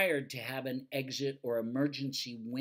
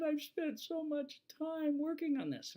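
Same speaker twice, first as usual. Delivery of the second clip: very high, whimpering imitation of a teacher; mock crying teacher. Teacher wails to the inspector about her work, high mock cry. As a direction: whine it high and teary like a weepy teacher. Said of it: "And she started crying".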